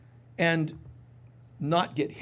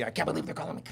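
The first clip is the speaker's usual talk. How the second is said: lower, softer annoyed mutter; irritated aside; 7 semitones lower, 6 dB quieter, much faster than usual. Retold or imitated. Listener grumbling at an unwanted call, lower mutter. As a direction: grumble at the phone. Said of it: "a call comes from somebody".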